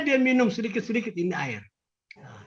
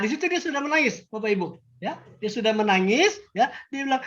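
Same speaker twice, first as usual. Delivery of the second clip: usual speaking voice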